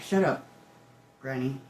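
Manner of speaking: normal talking voice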